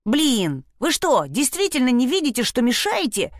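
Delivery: understated fierce yell. A narrator's reading. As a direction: scold the intruders without full volume. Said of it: "свирепо заорала Шпрота". (сдержанно сыгранный свирепый крик)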